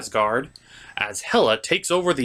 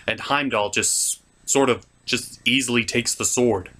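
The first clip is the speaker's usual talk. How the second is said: normal talking voice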